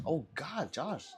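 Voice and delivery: usual speaking voice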